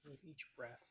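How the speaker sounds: soft, unhurried meditation voice with long pauses; guided meditation. Acted. Host leads listeners through a self-compassion breathing exercise, guided meditation. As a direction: ease listeners into the breath, quietly and gently.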